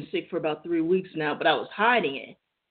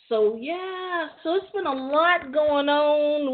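sing-song; 9 semitones higher, slower than usual. Pretend. Sing-song higher put-on voice.

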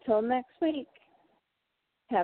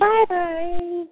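Kimono character voice; 9 semitones higher, 5 dB louder than usual. Pretend. Kimono voice signs off.

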